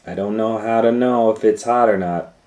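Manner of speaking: mock naive girl; playing dumb. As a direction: fake cluelessness.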